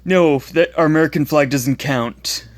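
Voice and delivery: taunting schoolyard bully voice; mocking bully. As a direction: jeer like an online troll. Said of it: "the ones that I, I quoted".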